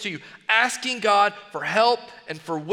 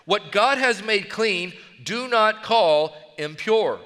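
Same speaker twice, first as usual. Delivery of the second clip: slow solemn command; slower than usual. Acted. God's voice gives slow command.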